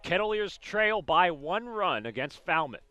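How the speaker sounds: usual speaking voice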